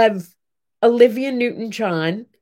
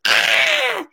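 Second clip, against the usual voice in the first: excited squeal; 13 semitones higher than usual. Retold or imitated. Sleepy child hears about flowers.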